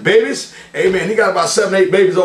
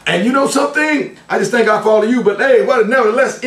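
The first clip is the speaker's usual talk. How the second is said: usual speaking voice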